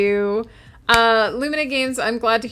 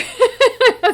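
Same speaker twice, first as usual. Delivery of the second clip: burst of laughter; 14 semitones higher, 6 dB louder than usual. Real, not acted. Streamer laughing at chat.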